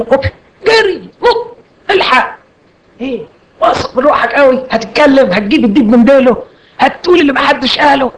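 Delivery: صوت أعلى بكثير بسخرية من الواعظ المتباهي؛ قلدها كواعظ متحمس واثق من نفسه زيادة؛ سخرية عالية من واعظ مغرور يندفع نحو المنبر; mocking overconfidence. Mocking an overconfident pulpit preacher.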